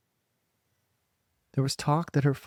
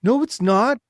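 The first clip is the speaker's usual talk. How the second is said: put-on high, loud protest from a crowd of kids; children crying out; 9 semitones higher, 9 dB louder than usual. A narrator's reading. Children insisting to the girl, loud and high. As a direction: shout it as the children. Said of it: "the children cried".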